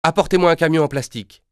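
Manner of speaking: put-on boy shouting his first order to the phone; exclaiming. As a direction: blurt out the order excitedly. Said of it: "je m'écrie".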